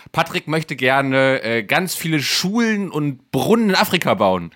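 slow, lofty do-gooder tone; mock noble. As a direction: play up the noble cause. Mock-grand guesses about Patrick.

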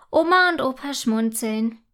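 ordinary delivery

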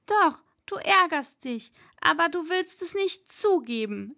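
high-pitched, teasing insistence; neckende Fatima. Acted. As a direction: needle him from up high. (hohe, neckende Mädchenstimme)

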